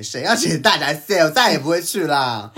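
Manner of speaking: scornful refusal of the crab offer; indignant outburst. Retold or imitated. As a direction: snap it back with real heat. Indignant outburst while retelling.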